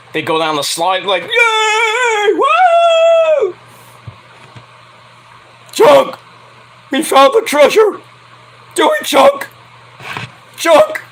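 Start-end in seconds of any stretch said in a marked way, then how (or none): 1.2-3.6 high drawn-out whoop of glee; हर्षित चीख. Retold or imitated. Retold movie slide ride cheering. 5.7-11.0 high, loud movie-character holler; voice-straining yell. Acted. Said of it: "I'm gonna lose my voice doing that".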